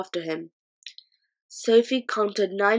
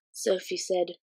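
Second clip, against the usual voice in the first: ordinary delivery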